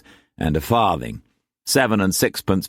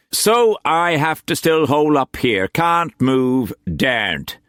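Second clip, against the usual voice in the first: higher, theatrical self-pity; Quance voice; 7 semitones higher than usual. A narrator's reading. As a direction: play the trapped invalid.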